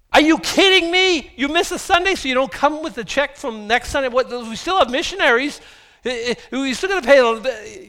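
hammed-up outrage at people skipping the check; mock outraged rant. Preacher imitating his own first thought, hammed-up outrage. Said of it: "what my first thought was".

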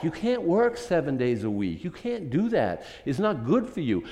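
usual speaking voice

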